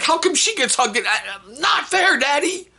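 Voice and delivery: high-pitched mock whiny tantrum; protesting kid. Son complaining to Daddy about fairness, mock protest. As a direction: imitate a child wailing at the injustice. Imitating son's protest to dad.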